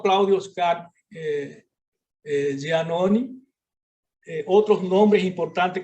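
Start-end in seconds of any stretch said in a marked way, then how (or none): none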